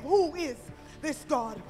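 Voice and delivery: ordinary talk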